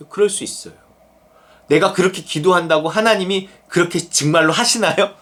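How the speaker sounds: ordinary talk, nothing marked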